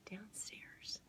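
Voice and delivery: plain reading voice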